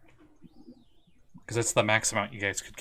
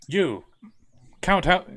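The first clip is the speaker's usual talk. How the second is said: devil boss barking orders; 8 semitones higher, 6 dB louder, slower than usual. Acted. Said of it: "and he goes".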